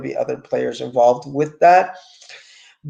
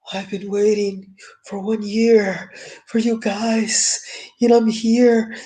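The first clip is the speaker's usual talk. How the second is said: mock dramatic [name]; 7 semitones higher, slower than usual.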